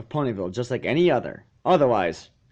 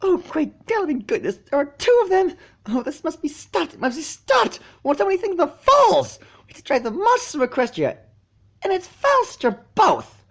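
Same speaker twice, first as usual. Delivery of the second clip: shrill, high, loud panicked rant; hysterical unicorn; 4 dB louder than usual. A narrator's reading. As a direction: shriek it like the town is ending.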